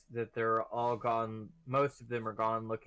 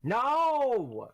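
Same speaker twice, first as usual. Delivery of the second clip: sudden loud cry of dismay; dismayed shout; 10 semitones higher, 6 dB louder than usual. Real, not acted. Player yelling at losing the draw, loud dismay. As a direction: yell no at the bad card.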